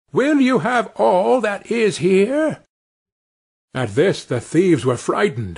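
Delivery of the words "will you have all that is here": high, slow mock bawl; little Tom hollers through the house. Tiny Tom bawls to the thieves inside the parson's house, a high hammed-up call. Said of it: "called out as loud as he could bawl".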